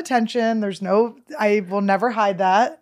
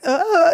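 higher, louder mock flustered "uh"; flustered groan; 8 semitones higher, 7 dB louder than usual. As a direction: imitate freezing up with a groan. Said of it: "I went like".

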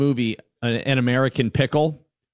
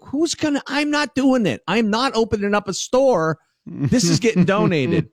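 exasperated refusal; 10 semitones higher than usual. Retold or imitated. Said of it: "And I'm like".

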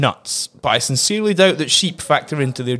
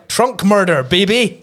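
excited; 6 semitones higher, slower than usual. Acted. Gleeful punchline.

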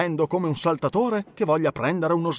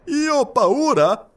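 high, slow, affronted question; indignant exclamation; 11 semitones higher, 4 dB louder, much slower than usual. A narrator's reading. Passepartout stung by the colonel's taunt, indignant exclamation. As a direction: throw the accusation back, high and drawn out. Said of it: "esclamò Passepartout".

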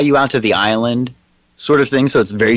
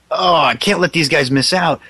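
higher voice, slowing into a drawn-out finish; killed rock band; 5 semitones higher, slower than usual. Acted. Dead band inviting riders to hell, hammed-up rocker voice. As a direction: ham it up as a rocker, stretching the last words. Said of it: "they should all get killed".

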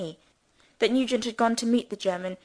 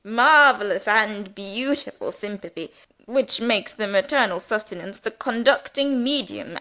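pompous rector; 5 dB louder than usual. Acted. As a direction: preach at them like a self-important parson. Rector's pathetic apostrophes.

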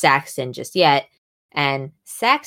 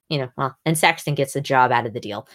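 ordinary delivery

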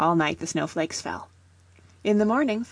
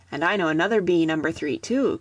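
usual speaking voice

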